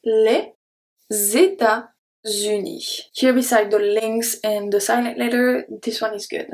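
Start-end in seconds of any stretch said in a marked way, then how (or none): 1.1-3.0 États-Unis said very slowly, each syllable separated; slow French demo. Shown as a demonstration. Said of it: "E S make the sound E".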